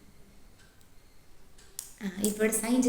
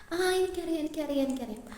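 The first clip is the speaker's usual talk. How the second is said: lightly acted eager know-it-all, pitched up; repeated, higher-pitched interjection; know-it-all teacher; 5 semitones higher than usual. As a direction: cut in as if you already know. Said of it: "എല്ലാം അറിയാവുന്ന teacher".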